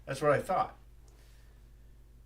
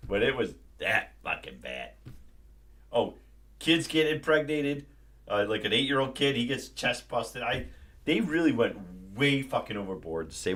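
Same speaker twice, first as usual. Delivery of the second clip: usual speaking voice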